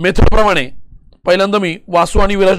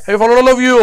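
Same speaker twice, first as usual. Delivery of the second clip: raised, more forceful call to the class; insistent check; 3 semitones higher, 3 dB louder, slower than usual. Real, not acted. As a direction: press the whole class for an answer. Repeats check, louder, for class response. (जोर देऊन विचारणे)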